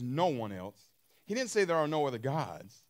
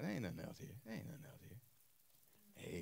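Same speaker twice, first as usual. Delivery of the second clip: hushed whisper; 8 semitones lower, 15 dB quieter than usual. Acted. Voicing God's quiet words.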